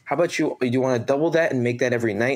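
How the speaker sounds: lightly imitated Jersey accent, quick patter; Dave Latour. Bar manager tempting a kid with better money, imitated Jersey accent. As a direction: make the pitch in a put-on East Coast accent. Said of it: "this very, like, Jersey".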